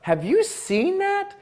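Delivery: high, slow, wide-eyed amazement; stretched-out wow of a delighted fan; amazed gush. Retold or imitated. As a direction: gush like you just saw something incredible. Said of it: "something like".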